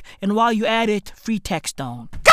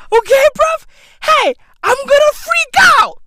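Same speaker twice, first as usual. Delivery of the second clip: much higher and louder yell; high shout; 14 semitones higher, 7 dB louder than usual. Acted. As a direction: shriek it out in mock panic.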